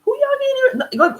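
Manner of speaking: very high, loud squawk; mock indignation. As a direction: break into a hammed-up, squealing challenge. Mock outburst at irritated friends.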